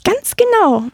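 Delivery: high, proud mouse pipe; proud little mouse. A narrator's reading. Mouse confirming it is the helper, high and proud. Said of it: "sagte die Maus stolz".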